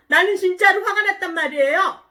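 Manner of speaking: high, loud indignant insistence; acted loud tantrum; angry protest. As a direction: insist crossly like a sulking child. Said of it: "요나가".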